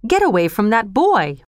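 forceful order, key words leaping up; commanding shout. A narrator's reading. Mister Brownlow protects wounded Oliver, forceful calling order. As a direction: order the attacker off, firmly.

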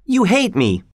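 high-pitched, heated accusation; contained angry cry; shouted. A narrator's reading. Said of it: "he shouted".

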